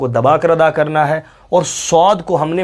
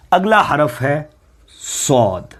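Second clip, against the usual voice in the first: ordinary delivery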